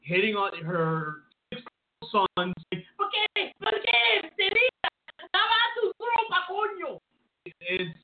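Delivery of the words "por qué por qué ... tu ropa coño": high scolding mom voice; shrill mock mother nagging in Spanish.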